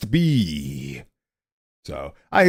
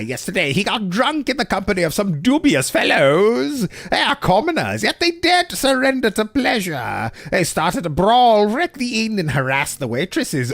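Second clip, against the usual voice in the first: haughty nobleman voice; 7 semitones higher than usual. Acted. Voicing the haughty brother Stefan.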